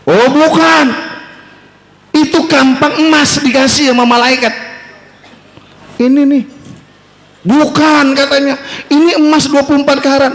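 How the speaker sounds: high, earnest voice of a humble woodcutter; humble woodcutter politely refusing; honest woodcutter. Acted. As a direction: refuse the gold axe, pitched high and sincere.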